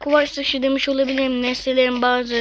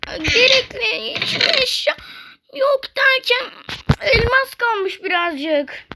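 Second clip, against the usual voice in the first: whimpering dismay; 8 semitones higher, slower than usual. Real, not acted. Finds nearly nothing left.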